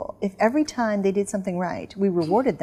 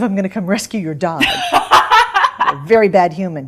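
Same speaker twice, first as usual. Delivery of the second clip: laughing through the words, louder; 7 dB louder than usual. Real, not acted. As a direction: laugh while scolding a bad owner. Laughs at dog owner joke.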